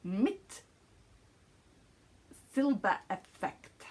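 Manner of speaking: mock German accent on the yarn name; playful German accent. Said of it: "my amazing German accent".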